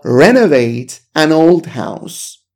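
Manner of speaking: very slow, careful English; slow model reading. Shown as a demonstration. Teacher reviewing the collocation, very slowly. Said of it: "Renovate a house".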